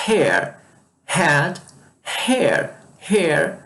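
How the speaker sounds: "Hair" with an audible puff of H; English breathy H. Shown as a demonstration. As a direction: start "Hair" on an exhaled H. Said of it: "Esse H tem um som de".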